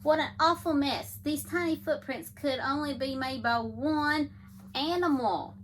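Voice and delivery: ordinary delivery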